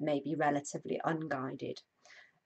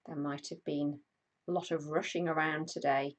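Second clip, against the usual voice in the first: guided meditation; 3 dB louder than usual. Acted. Host guiding a live stream body scan, calm meditation voice. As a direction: ease listeners into stillness, unhurried.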